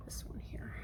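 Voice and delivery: very soft whispered aside. Real, not acted. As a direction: murmur it under your breath. Aside while covering bowl.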